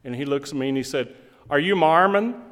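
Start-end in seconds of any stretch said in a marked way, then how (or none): 1.5-2.4 imitating the Utah local's lilt, rising at the end; mock the way folks out there ask it. Preacher imitating a Utah man at the door, local way of asking. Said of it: "That's the way they would say it out there".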